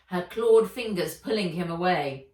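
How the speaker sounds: ordinary delivery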